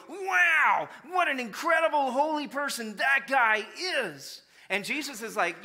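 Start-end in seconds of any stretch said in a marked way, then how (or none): 0.1-4.4 high, loud, over-the-top admiration; mock gushing onlooker. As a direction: ham up a dazzled bystander praising the holy man. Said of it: "to go".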